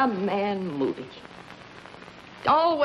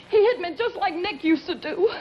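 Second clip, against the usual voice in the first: rising, frantic and much higher pitched; coming apart, voice climbing higher; hysterical panic; 7 semitones higher than usual. Acted. Woman tells Moody she's back with Nick, hysterical and much higher. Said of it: "you're blowing your top".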